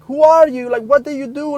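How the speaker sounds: high, loud, insistent questions; retold amazed mom; astonished mother. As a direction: ask it in stunned disbelief.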